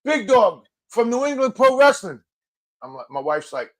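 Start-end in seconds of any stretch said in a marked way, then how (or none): 0.0-2.2 high-pitched fan holler; mock shout of recognition, pitched up; imitated call-out. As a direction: hail him like an excited fan. Retold fan calling out to him.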